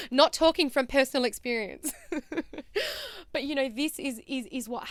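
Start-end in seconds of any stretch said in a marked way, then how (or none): 2.0-3.3 high, softer trailing laugh; giggle. Real, not acted. Speaker joking about personal experience, high giggle. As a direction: giggle after your own confession.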